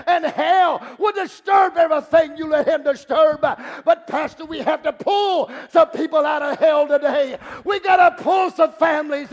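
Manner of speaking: fervent pulpit shouting; full-force preaching. Real, not acted. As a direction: drive every phrase at the congregation.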